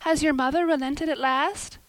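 put-on high, loud hopeful girl; eager girl asking her friend for good news; eager question. A narrator's reading. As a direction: burst out with hope. Anne hopeful, excited.

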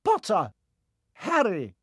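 calling out a name. A narrator's reading. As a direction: call Harry up to the stool.

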